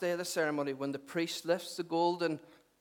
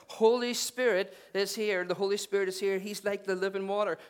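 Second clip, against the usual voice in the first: raised pitch, driving sermon delivery; impassioned preaching; 4 semitones higher than usual. Acted. Preacher voicing Jesus to a waiting crowd, impassioned. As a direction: press the announcement on the congregation, building.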